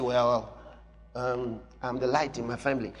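timid mock quote. Preacher mocking a timid believer, small hesitant voice. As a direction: shrink it down like a shy believer. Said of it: "So you say".

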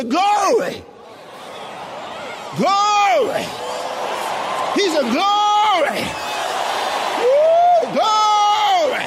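loud, high exultant shouts with a whoop; irrepressible, drawn-out shouts; noisy praise shouting. Retold or imitated. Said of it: "That man was a noisy fellow".

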